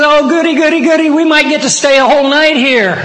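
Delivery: high, gleeful child imitation; excited little daughter. Daughter telling the other kid about camping out, high excited voice. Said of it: "She gets all excited".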